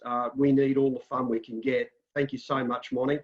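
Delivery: normal talking voice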